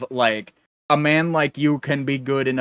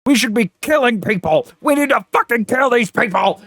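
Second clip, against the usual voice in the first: higher, louder ranting mock zealot; mock angry Trump supporter; 8 semitones higher, 5 dB louder than usual. Mocking a Trump supporter, in a higher, louder mock rant. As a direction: ham up a furious radical. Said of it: "still support him and are like".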